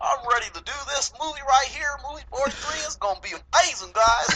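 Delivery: put-on high-pitched hillbilly Southern accent; Cletus from The Simpsons. Co-host mocks what he was expecting on the movie show, hammed-up Southern accent. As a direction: lay on an excited, comic Southern twang. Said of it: "Sound like Cletus from The Simpsons".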